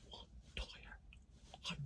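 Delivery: hushed whisper, no voice at all. Retold or imitated. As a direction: breathe it out as a shocked aside. Reading a comment, pricey tyre.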